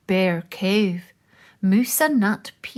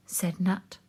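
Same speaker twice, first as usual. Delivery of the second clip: ordinary delivery, nothing marked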